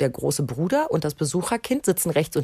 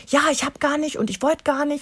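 put-on defensive kid; 9 semitones higher than usual. Kind verteidigt sich.

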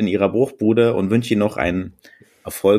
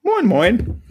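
high falsetto greeting; 14 semitones higher than usual. Acted. Caller greets in put-on voice.

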